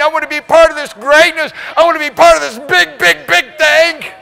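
prayer rising to a high, loud shout; pleading prayer that builds to a shout; crying out to God. Retold or imitated. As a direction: cry out to God, building to full voice. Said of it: "I'm crying out".

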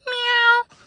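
high squeaky meow; cat meow. Acted. The cat answering the old man, squeaky meow. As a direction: mew like a house cat. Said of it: "Mike said".

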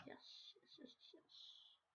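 breathless whispered yeses; mock whisper. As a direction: hiss the yeses like a secret.

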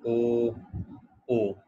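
ओ rounded demo; pinyin w sound. Shown as a demonstration. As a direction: round the lips for ओ. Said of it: "डब्ल्यू को बोलेंगे". (डब्ल्यू को ओ बोलना)

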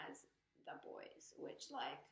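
breathy whisper of the words. Real, not acted. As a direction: whisper it like it hurts. Fan overcome, whispering.

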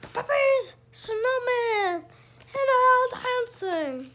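high delighted listing; excited. Real, not acted. Parent pointing out puppies to the child, excited. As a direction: squeal over each picture.

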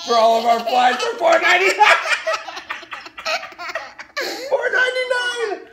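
fast and high, bursting into squeals; hysterical excitement. Real, not acted. Parent announcing fly trap price, shrieking excitedly. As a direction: squeal the price through laughter.

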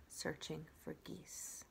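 trailing whisper. A narrator's reading. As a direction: breathe the last words out. Narrator drops to whisper.

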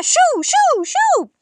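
very high, loud shoo and chirping cries; shrill shooing that turns into birdlike chirps; frantic shooing. A narrator's reading. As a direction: flap the birds away, squealing. Grandma shooing birds, mimicking chirps.

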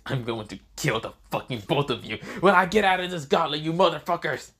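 acted rage building to a yell; furious threat. Trapped dragon threatens both mockers from the gauntlet, in loud fury.

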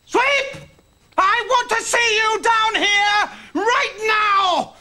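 high, slow, angry summons. Acted. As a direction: bellow for the culprit to come. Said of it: "no need to raise your voice like that".

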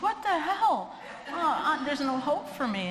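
high, slow panicky wail; comic high-pitched fear, half laughing; mock panic. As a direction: play up the terror of the moment. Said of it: "I'm like".

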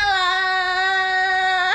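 loud, high, long-held wail; high sustained yell of defeat; drawn-out losing cry. Real, not acted. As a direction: cry out and hold the last vowel.

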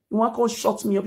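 ordinary talk

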